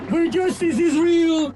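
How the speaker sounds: normal talking voice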